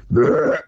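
rolled deer grunt; short burring animal grunt; imitated buck grunt. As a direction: mimic the buck grunting as it stops. Imitates the buck's grunt when stopping.

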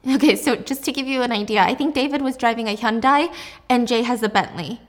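usual speaking voice

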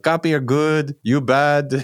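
put-on high-pitched, clumsy Israeli accent; badly faked Israeli accent, played for laughs. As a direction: try dad's Israeli accent and fail comically. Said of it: "how to do an Israeli accent".

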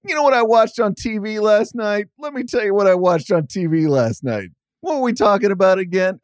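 high, hammed-up blowhard rambling; mock radio guy droning about TV; mocking rambling host.

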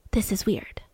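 storyteller whispering a creepy coincidence; conspiratorial whisper. Acted. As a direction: whisper it as a secret. Suspicious coincidence.

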